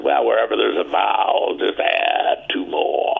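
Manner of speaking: exaggerated Texan drawl, vowels stretched out long; slow, drawn-out Texan accent. Dallas friend coaching an actor to talk Texan, exaggerated Texan drawl. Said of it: "talk like a Texan".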